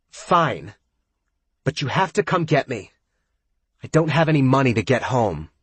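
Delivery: put-on high, sulky drunken snap; snapping. A narrator's reading. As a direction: snap back sulkily. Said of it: "sighs before snapping at Brooke".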